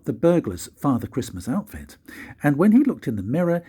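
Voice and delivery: ordinary delivery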